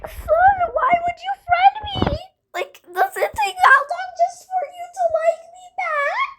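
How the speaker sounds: high, whiny put-on voice; mock whiny complaint. Kid mocking friend over friend request, high whiny voice. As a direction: whine at him like a hurt friend.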